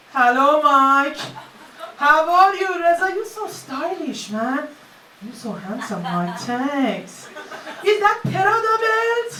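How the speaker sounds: lightly put-on Persian accent, loud and slow; mock Persian accent. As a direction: greet like a showy Persian friend, hammed up. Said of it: "Persian accents all of a sudden".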